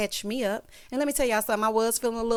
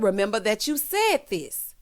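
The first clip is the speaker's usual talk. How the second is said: ordinary talk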